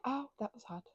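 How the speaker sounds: ordinary talk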